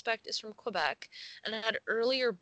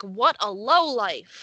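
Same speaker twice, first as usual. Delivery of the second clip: slow, loud scorn; loud scornful; 10 dB louder, slower than usual. Retold or imitated. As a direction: belt out the insult. Repeating quote with gusto.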